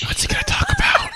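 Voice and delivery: giggly stage whisper. Pretend. Co-host asking about the caller, giggling whisper. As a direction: whisper it, breaking into laughter. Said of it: "Why are we whispering?".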